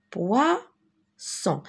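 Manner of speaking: "poisson" drawn out with an unvoiced s sound; hissed double S. Shown as a demonstration. Teacher showing learners a double S, "poisson" with a clear s. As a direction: say "poisson" slowly, a clear s in the middle. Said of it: "Donc, je dis poisson".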